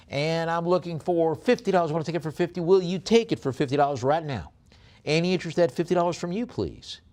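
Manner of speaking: fast, high, loud auctioneer chant; rapid-fire auction call; auction bid calling. Acted. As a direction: rattle off the bids like a live auctioneer. Pleading for bids.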